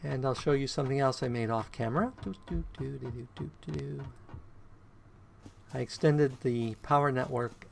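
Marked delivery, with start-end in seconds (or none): none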